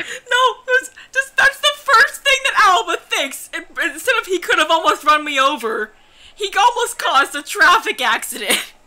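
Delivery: high-pitched, cracking with laughter; real laughing squeal, loudly incredulous; amused, laughing disbelief. Real, not acted. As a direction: struggle to explain through giggles. Laughing comment on the character's reaction.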